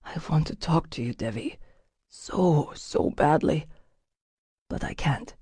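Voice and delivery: very soft, hushed confession; whispered. A narrator's reading. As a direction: breathe it into her hair. Rupert whispers against her hair.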